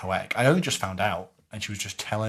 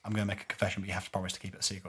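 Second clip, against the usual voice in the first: hushed, hurried retold confession; confiding confession; 2 semitones lower, 7 dB quieter, much faster than usual. Nun pulls the priest aside to confess, hushed and hurried. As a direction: confide it quickly under the breath.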